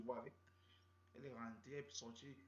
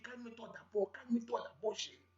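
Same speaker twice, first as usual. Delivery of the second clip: pleading; 7 semitones higher, 9 dB louder than usual. Retold or imitated. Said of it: "Je dis".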